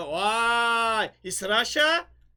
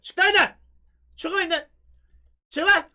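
loud sharp get out; angry command; 5 semitones higher, 7 dB louder than usual. Real, not acted. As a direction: shout him out of the game. Telling player to leave.

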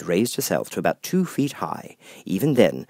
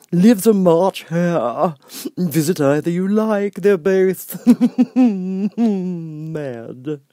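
grinning Cheshire Cat; 10 semitones higher, 7 dB louder, much slower than usual. Acted. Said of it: "the cat said".

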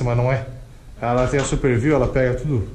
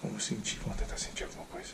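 whispering; 6 semitones lower, 18 dB quieter than usual. Acted. Ghost hunt, listening in silence.

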